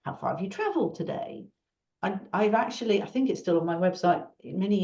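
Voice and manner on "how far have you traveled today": much higher, bright questions to a stranger; mock cheery conference chatter; chatty small talk. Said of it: "it's just like".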